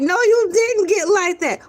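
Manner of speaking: high, shocked protest; mock shock. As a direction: react like you can't believe it. Shocked reaction to vampires.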